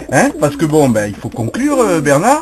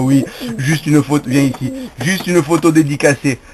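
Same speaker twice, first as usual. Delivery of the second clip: ordinary talk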